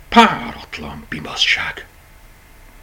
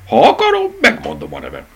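squeaky, much higher rabbit voice, teasing; cheeky rabbit; 4 dB louder than usual. A narrator's reading. Rabbit teases the toys, high and cheeky. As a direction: play the sassy intruder.

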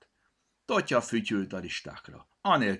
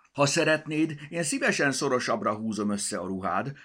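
calling out; 7 semitones higher, 6 dB louder than usual. A narrator's reading. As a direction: call out teasingly from a hiding place. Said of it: "kiabálta".

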